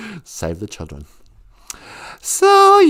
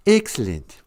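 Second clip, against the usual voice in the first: a lightly voiced, lower scheming old boss; Mister Burns; 9 semitones lower than usual. Streamer doing Mister Burns for the chat, a lightly voiced scheming tycoon. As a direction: purr it like a cartoon tycoon. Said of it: "Mister Burns from The Simpsons".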